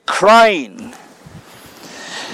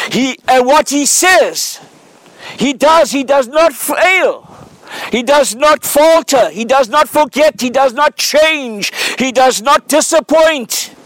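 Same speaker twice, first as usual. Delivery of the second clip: preaching; 3 semitones higher than usual. Acted. Preaching cadence, repeated not.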